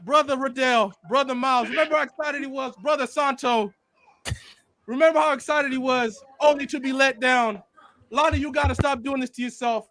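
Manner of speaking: solemn preacher addressing the congregation; hammed-up funeral sermon voice; eulogy for a fan let down by Tokyo Ghoul; mock preacher eulogy.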